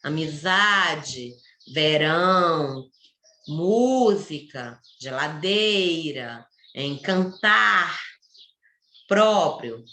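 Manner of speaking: each word drawn out on its stressed syllable in "Amizade, verão, música, geladeira, encantar, próprio"; loud and slow. Shown as a demonstration. Teacher showing stressed syllables, "Amizade, verão, música, geladeira, encantar, próprio" slow and emphatic. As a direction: slowly lean on ZA, RÃO, MÚ, DEI, TAR, PRÓ in "Amizade, verão, música, geladeira, encantar, próprio". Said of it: "Quando eu falo ela alto e devagar".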